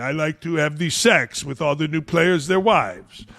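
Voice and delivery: lightly done mock French accent; a hint of the French accent, smug; Tony Parker French accent. Host mocking Parker between his real quotes. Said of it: "I kinda like the accent".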